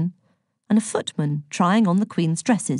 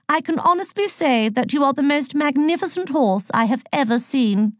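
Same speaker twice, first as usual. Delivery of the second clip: higher, regal and gracious; gracious Queen; 8 semitones higher than usual. A narrator's reading. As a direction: compliment the horse like a gracious monarch. Narrator voices the Queen.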